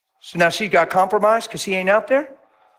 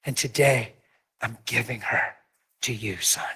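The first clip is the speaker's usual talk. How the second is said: a lightly voiced father, softer and lower, handing her over; father giving away the bride; 3 semitones lower, 7 dB quieter than usual. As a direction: gently hand your daughter to the groom. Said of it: "as if saying".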